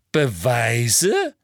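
put-on voice rising into a challenge; Umbridge questioning. Umbridge challenges Dumbledore over the evidence, pointed question.

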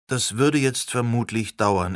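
usual speaking voice

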